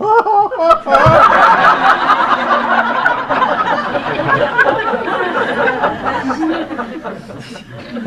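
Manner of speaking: long, high real burst of laughter. Real, not acted. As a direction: let the laugh run out fully. Teller laughs after guru punchline.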